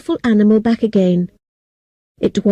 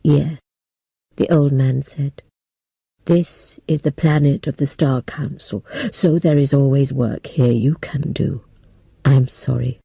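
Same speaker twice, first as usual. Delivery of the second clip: put-on lightly lowered, grave old man's voice; a hint of a sorry old man; 7 semitones lower, 3 dB quieter than usual. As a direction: speak as a solemn elder breaking hard news. Old man speaks again.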